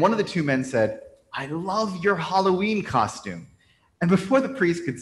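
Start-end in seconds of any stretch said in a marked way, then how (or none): 1.3-3.5 drawn-out, higher-pitched compliment; gushing compliment. Acted. Stranger compliments a priest on his costume, gushing. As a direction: slowly savour the admiration, pitched up.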